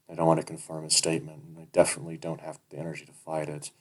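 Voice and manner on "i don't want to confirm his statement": ordinary delivery, nothing marked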